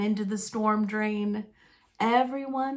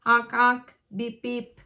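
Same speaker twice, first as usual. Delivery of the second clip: imitated horn. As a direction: honk honk beep beep car horn. Fire truck horn sounds.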